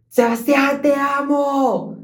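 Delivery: high, loud, slow love shout. Retold or imitated. Girl yelling her love across the bus, high slow shout. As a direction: yell it across the bus, stretched out. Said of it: "grita en el bus y dice".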